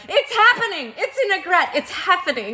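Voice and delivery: high, thrilled; excited. Retold or imitated. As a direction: squeal with anticipation, laughing. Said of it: "and I'm like".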